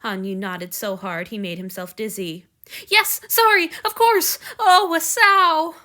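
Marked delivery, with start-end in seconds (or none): 2.9-5.8 high, loud and breathless; flustered rush. Acted. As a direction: blurt it out, overwhelmed with relief. Hanyu overwhelmed seeing injured friend.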